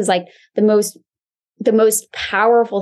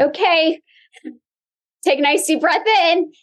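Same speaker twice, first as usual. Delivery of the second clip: high, brisk Pilates instructor cues; coaching cues; 9 semitones higher than usual. Retold or imitated. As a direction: call it out like a class instructor. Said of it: "the first thing I do is I say".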